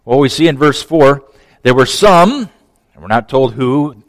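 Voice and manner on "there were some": ordinary talk, nothing marked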